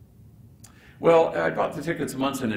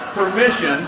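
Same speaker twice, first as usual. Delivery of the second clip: loud and higher, laughing; triumphant cry; 8 semitones higher, 10 dB louder than usual. Real, not acted. Telling the crowd they got permission, loud and laughing. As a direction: announce the win with a laugh.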